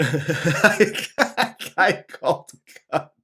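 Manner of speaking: broken up by laughter; laughing. Real, not acted. Host repeats co-host's joke, helpless laughing. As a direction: squeeze the words out through giggles.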